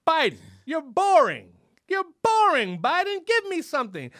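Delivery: high, loud, drawn-out mock shout; exasperated heckling. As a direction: heckle the president for being dull. Heckles an absent Biden.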